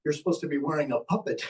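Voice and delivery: usual speaking voice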